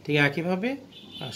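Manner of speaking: usual speaking voice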